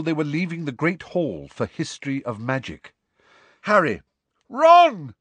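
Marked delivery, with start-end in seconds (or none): 3.6-5.1 loud, high, urgent shout; desperate, rising yell; calling out. A narrator's reading. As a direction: call friends urgently across the hall. Angelina calls, hurrying up desperately.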